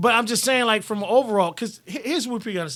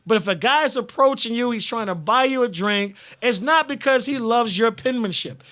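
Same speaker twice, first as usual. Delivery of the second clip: ordinary delivery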